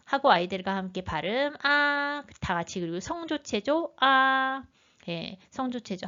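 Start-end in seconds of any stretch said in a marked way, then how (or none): 1.6-2.2 아 held high and level; Mandarin first tone. Shown as a demonstration. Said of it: "일 성을 눌러주시면". 4.0-4.6 아 held level above usual pitch; Mandarin first tone. Shown as a demonstration. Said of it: "일 성을 눌러주시면".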